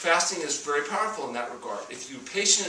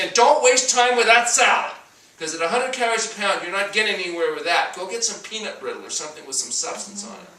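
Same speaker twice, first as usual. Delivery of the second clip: loud, urgent voice of a craving brain, pitched higher; pushy brain voice; 6 semitones higher, 9 dB louder than usual. Pretend. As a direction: bark it like a panicking brain pushing junk food. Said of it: "Your brain is saying".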